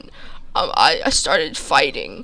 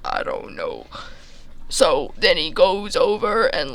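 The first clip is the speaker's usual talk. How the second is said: ordinary talk, nothing marked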